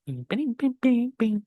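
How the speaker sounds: high pitched ping, ping notes; mimicked ping sound. Real, not acted. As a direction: imitate the screen share chime. Computer sound imitated. (sonido ping imitado agudo)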